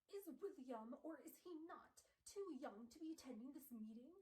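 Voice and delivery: high, put-on snooty voice; hammed-up haughty objection; Gabriel Lightwood. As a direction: question it like a pompous rival. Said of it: "Gabriel's like".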